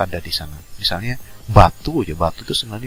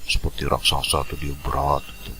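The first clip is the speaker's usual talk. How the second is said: slightly heavy, lower-pitched giant; 4 semitones lower, 4 dB quieter than usual. Shown as a demonstration. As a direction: lightly suggest a big, heavy character. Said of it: "voice tone-nya biasanya berat". (suara raksasa agak berat)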